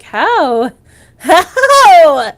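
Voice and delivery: loud astonished how; amazed disbelief. Retold or imitated. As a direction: shout it in amazement. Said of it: "and I'm like".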